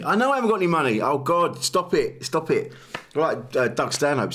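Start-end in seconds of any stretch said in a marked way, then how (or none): 0.0-2.7 exasperated pleading. Retold or imitated. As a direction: plead with your thoughts. Arguing with own thoughts.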